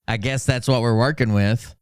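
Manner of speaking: ordinary delivery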